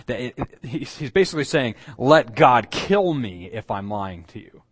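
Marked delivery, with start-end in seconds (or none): 2.0-4.6 loud, slow, weighty oath; vehement self-curse. Acted. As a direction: call down judgment on yourself, slowly and forcefully. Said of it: "it's a strong term that involved pronouncing death".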